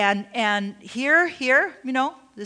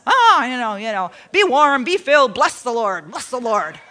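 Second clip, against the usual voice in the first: louder, hammed-up pious brush-off; mock churchy pat answer; 5 dB louder than usual. Pastor mocking churchgoers' glib replies, hammed-up pious voice. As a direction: parody a church person tossing off blessings.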